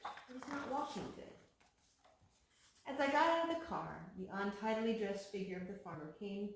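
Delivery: ordinary delivery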